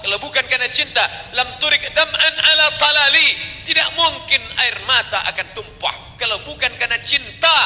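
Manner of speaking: high, loud impassioned preaching; preaching at full force. Acted. Preacher pleads to the crowd about tears of love, loud and high.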